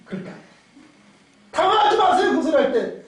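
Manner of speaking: ordinary talk, nothing marked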